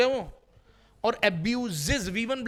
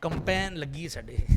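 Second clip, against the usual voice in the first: imitating local folk; 4 semitones lower than usual. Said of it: "लोग क्या कहते हैं?".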